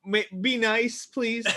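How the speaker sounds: ordinary talk, nothing marked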